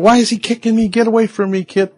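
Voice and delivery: high, irritated protest; angry, frustrated. Acted. Preacher acts someone shooing away a kicking child, frustrated. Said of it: "Angry, you get frustrated".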